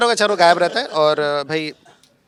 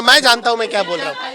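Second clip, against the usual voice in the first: ordinary talk, nothing marked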